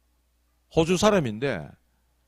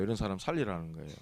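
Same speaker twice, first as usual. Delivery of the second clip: much lower and softer, quick, 살리라는 barely lifted; quiet fast echo; 10 semitones lower, 14 dB quieter, faster than usual. Shown as a demonstration. Preacher to congregation, a soft quick echo. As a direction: murmur it back fast as an afterthought. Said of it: "repeats the line in a new way".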